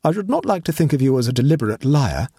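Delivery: icy, measured accusation; cold observation. A narrator's reading. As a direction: observe it coldly, with formal restraint. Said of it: "he observed coldly".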